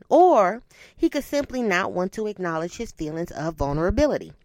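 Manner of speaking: usual speaking voice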